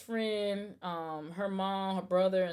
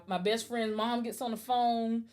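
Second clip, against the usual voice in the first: ordinary delivery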